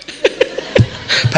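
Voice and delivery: breathless high laugh; a wheezing laugh at his own joke; airy laugh. Real, not acted. Laugh after punchline. (tawa terengah)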